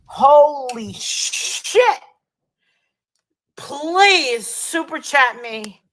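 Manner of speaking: drawn-out loud begging; loud pleading. Real, not acted. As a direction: beg the chat, stretching the words. Overwhelmed begging for super chats.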